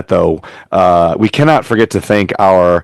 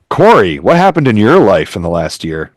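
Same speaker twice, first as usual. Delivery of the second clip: usual speaking voice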